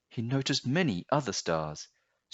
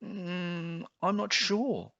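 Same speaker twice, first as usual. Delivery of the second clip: put-on higher, unsure little boy voice; child voice, pitched high and hesitant; young boy; 10 semitones higher, slower than usual. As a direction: sound like a puzzled small boy. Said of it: "said the boy".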